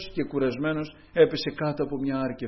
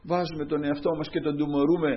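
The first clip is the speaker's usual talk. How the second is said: ordinary delivery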